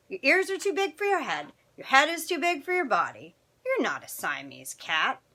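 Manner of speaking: high, singsong kitten voice; kitten at mirror. Acted. Skippy to the doggy in the mirror, high-pitched.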